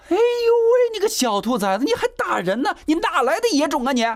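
very high, loud opening cry, then rapid abuse; furious scolding. A narrator's reading. Mother rounds on boy who hit son, furious scolding. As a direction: rail at the culprit in a sky-high pitch.

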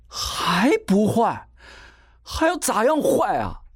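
high-pitched, drawn-out incredulous retort; outraged disbelief. A narrator's reading. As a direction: throw the words back at her, stretched and high. 弟弟愤然反问，随后踢门. (拖长音、高调愤然反问)

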